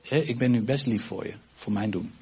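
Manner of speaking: ordinary talk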